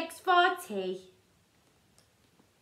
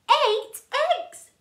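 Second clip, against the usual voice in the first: excited; 10 semitones higher, 7 dB louder than usual. Acted. Excited counting.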